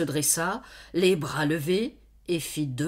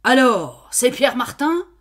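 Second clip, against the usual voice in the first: angry questioning; 7 semitones higher, 10 dB louder than usual. Acted. Farmer guessing in anger.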